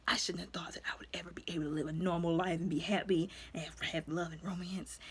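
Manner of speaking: fast, hushed, broken-down whisper; grieving whisper. Retold or imitated. Widowed hero lamenting his lost happy life, whispered. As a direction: confess it under the breath.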